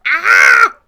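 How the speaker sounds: sudden loud yelp; startled yelp. Real, not acted. Streamer startled by something in the corner, yelping. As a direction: jump at it.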